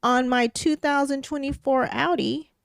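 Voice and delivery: rising outrage as she recounts; worked-up telling. Real, not acted. Building outrage telling story.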